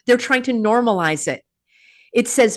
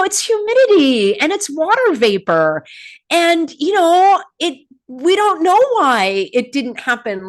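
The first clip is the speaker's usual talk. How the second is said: high, singsong mock excuse; hammed-up innocent explaining; mocking imitation; 8 semitones higher, 4 dB louder than usual. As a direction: parody an official playing clueless. Mimics agencies' excuses mockingly.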